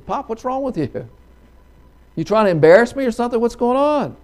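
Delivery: high-pitched, agitated son's protest; rising, flustered demand for an explanation; exasperated. Acted. Embarrassed son running in to his father, exasperated.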